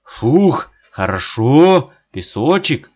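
отдуваясь в ледяной воде, громко; выдохнуть с кряхтеньем, довольно; кряхтя. A narrator's reading. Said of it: "кряхтит". (кряхтит в проруби)

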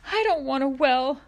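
high, loud tearful protest; sobbing. A narrator's reading. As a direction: wail it as if crying. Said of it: "Ma sobbed".

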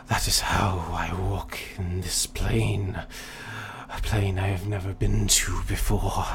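slow, whispery greedy dragon. Acted. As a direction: hiss it out like a power-hungry dragon. GM voices the dragon.

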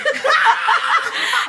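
a burst of high, loud laughter; high emotional laugh. Real, not acted. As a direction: laugh it out, moved and giddy. Emotional laugh, near tears. (risada alta e emocionada)

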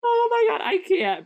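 high, loud oh my God; high delighted squeal; excited exclaim. Real, not acted. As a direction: burst out in delight. Laughing exclamation.

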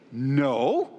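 low start swooping up into a question; drawn-out, deadpan disbelief on one word; mock-incredulous no. Preacher answering for Moses, mock incredulous.

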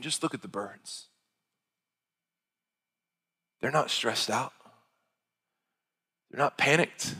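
lightly voiced Jesus calming a worried listener; gentle reassurance. Pastor voicing Jesus to the worried, softly reassuring. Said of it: "He's saying".

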